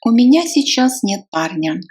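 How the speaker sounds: ordinary delivery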